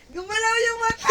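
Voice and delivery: high loud shout of alarm; sister's startled shout. Retold or imitated. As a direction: yell it like you caught someone faking. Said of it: "sabi ni ate".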